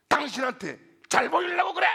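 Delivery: high, loud exasperated retort; hammed-up indignant outburst; imitated angry yell. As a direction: act out a wife shouting back, climbing to a shriek. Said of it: "아내가 화가 막 치밀어 올라가지고".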